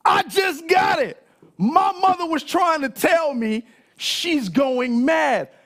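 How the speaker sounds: shouting high with laughing excitement; loud realization. Real, not acted. Preacher shouting his realization to the congregation, laughing. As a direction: blurt out the sudden discovery. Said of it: "bust out loud".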